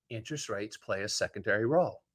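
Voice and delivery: "Interest rates play a secondary role" said low and softly; repeating for emphasis. Real, not acted. Host answering a listener who asked, repeating it low. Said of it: "the reason I keep repeating that".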